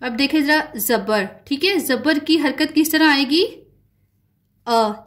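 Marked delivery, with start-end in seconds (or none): 3.4-5.0 a clear, isolated short vowel "अ"; short a sound. Shown as a demonstration. Teacher showing pupils the zabar sound, clear short a. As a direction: sound out "अ" on its own, open and brief. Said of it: "जैसे अ sound आता है ना".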